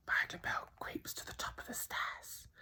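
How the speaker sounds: breathy suspense whisper. A narrator's reading. Narrator creeping with Bill up the stairs, whispering. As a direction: whisper it as you sneak.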